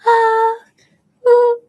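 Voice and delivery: very high, loud falsetto notes. Shown as a demonstration. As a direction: soar up high and ring out. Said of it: "It's like".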